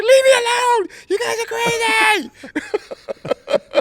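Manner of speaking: put-on very high, loud, crying yell of a kid fighting off the prayer; retold screaming kid. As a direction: scream it at them as a desperate ten-year-old. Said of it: "I'm screaming and hollering like".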